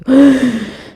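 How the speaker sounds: loud sudden high gasp for air; sharp gasp. Shown as a demonstration. Teacher showing hyperventilation to the class, a sharp loud gasp. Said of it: "we begin to".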